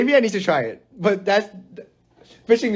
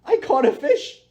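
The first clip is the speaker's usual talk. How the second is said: higher, faked thrill at a catch; mock excitement; 9 semitones higher, slower than usual. Mocking an angler's thrill at a catch, higher and hammed-up. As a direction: pitch it up, poking fun. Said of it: "and you go".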